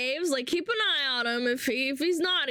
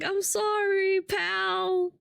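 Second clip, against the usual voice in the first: higher, pitiful mock apology; hammed-up sad little voice; imitating apologetic viewer; 7 semitones higher, much slower than usual. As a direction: play the sorry drunk guy, pitched up. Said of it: "he's like".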